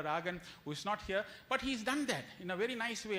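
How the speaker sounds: ordinary delivery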